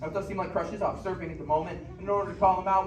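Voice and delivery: normal talking voice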